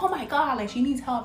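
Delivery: high, loud acted outburst of alarm; mock alarm. Coworkers suddenly realizing the nurse needs help, mock alarm. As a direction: cry out as a startled onlooker. Bystanders snap out and react.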